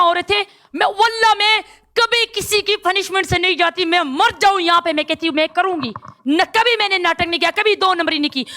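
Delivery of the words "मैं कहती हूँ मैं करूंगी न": ordinary delivery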